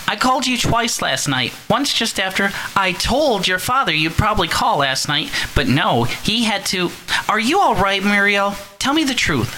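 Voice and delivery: high, fretful mother on the phone; worried mother. Acted. Mother phoning her daughter, high and anxious. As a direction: fuss anxiously over your daughter.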